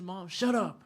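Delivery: loud mock yell at a mother; retold yelling. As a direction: imitate a kid snapping loudly at his mom. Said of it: "He's yelling at the mom".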